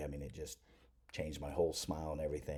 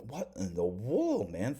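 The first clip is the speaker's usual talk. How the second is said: surprised; 4 semitones higher, 4 dB louder, slower than usual. Retold or imitated. Lip glued to gum, baffled.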